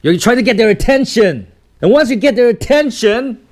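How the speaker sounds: building to a loud, high call; really loud. Shown as a demonstration. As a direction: push it up loud to get their attention. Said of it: "Sometimes you're really loud".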